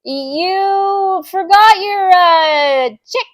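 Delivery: high, drawn-out, loud call; calling after someone. Acted. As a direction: call out slowly across the room, pitched high. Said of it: "he heard Jim calling him".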